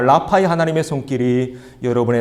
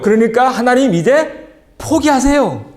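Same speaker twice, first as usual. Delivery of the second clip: higher, whiny voice of a stubborn refuser; stubborn Moses whining; 6 semitones higher, 4 dB louder than usual. Acted. Pastor voicing Moses refusing God, whiny and higher. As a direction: play Moses digging in his heels, pleading to be left alone.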